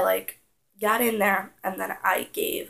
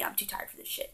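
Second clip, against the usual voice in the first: usual speaking voice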